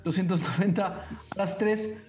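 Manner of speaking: más agudo y rápido, cerrando el remate; a la una, a las dos, vendido; subastador cerrando la venta. Acted. Cierre de la subasta. (subastador rematando con prisa)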